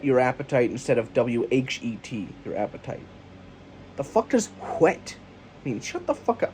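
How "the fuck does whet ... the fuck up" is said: high mocking voice. Acted dialogue, high voice.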